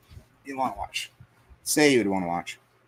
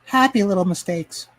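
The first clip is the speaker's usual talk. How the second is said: Bob Ross impression; 7 semitones higher, 4 dB louder than usual. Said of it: "Bob Ross, baby".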